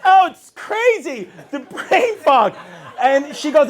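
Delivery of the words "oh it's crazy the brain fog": mock wail, pitched high; complaining wife. Wife lamenting her brain fog, mock-distressed. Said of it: "That's her new thing".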